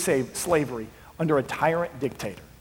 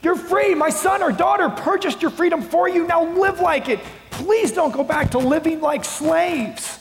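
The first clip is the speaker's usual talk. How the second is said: much higher and louder, pleading cry; shouting from the rooftops; 13 semitones higher, 4 dB louder than usual. Pretend. Grieving parent shouting at freed country, desperate loud plea. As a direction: shout it with all your lungs to the freed people. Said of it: "You would be shouting from the top of your lungs".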